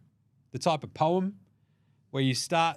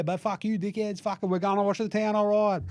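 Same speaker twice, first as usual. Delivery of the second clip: pitched-up mock swagger; defiant coach voice; 6 semitones higher than usual. Coach defying critics, higher cocky mock voice. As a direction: toss it off cocky.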